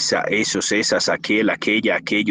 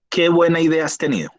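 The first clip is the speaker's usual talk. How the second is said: example exclamation with raised pitch; teacher demonstrating an exclamation; exclamatory example; 7 semitones higher than usual. Shown as a demonstration. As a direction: read it out as a higher-pitched exclamation. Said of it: "Ahí, exclamación".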